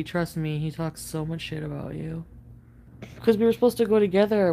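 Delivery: normal talking voice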